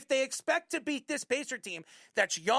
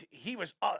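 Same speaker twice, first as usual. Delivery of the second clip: usual speaking voice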